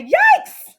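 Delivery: very high, loud startled yikes; shocked yelp. Acted. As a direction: jump out of your skin. Father shocked by the cart.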